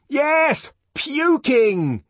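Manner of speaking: high, loud insult, fairly shouted; furious high shout. A narrator's reading. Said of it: "he fairly shouted".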